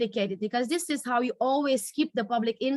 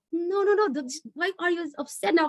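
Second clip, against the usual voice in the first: flustered husband; 7 semitones higher than usual. Acted. Acted quarrel, husband backpedals.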